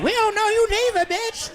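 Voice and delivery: high, sharp mocking comeback; woman snapping back at a stranger; sassy retort. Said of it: "She's like".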